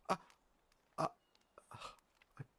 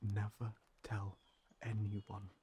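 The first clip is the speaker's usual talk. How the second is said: ordinary talk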